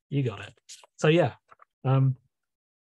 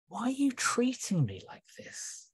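hurt whisper, higher; whispered Neptune; 9 semitones higher, 6 dB quieter than usual. Acted. The sea asking humans why they hurt it, whisper. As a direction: breathe the question as a wounded whisper. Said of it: "in the voice of Neptune".

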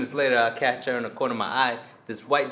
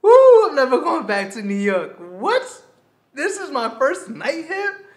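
high, slow, whooping joy; overjoyed rave; 12 semitones higher, 6 dB louder, slower than usual. Retold or imitated. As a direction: celebrate like you hit the jackpot. Overjoyed at the invitation.

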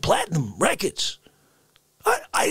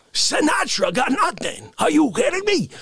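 usual speaking voice

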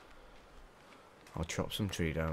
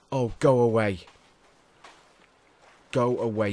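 shout; 4 semitones higher, 10 dB louder than usual. Real, not acted. Shooing off attacking animals.

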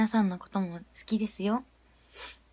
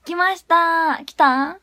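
higher, louder happy call; excited; 5 semitones higher, 6 dB louder, much slower than usual. Real, not acted. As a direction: announce it with a burst of joy. Video finally arrives.

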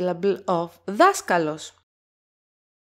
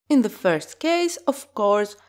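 ordinary talk, nothing marked

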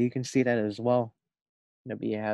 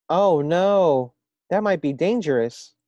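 alarmed; 7 semitones higher, 12 dB louder, slower than usual. Acted. Frog alarmed at a kiss.

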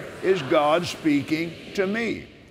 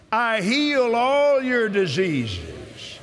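preaching at full force; 6 semitones higher, much slower than usual. Acted. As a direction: shout the promise like from the pulpit.

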